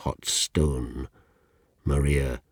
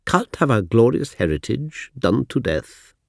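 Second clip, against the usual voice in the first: posh teacher; 7 semitones higher, 4 dB louder than usual. Retold or imitated. Teacher scolding pupil.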